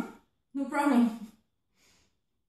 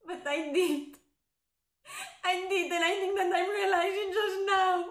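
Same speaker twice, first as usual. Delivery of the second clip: high, wailing confession; tearful breakdown; 6 semitones higher than usual. Acted. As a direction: break down as the feeling hits.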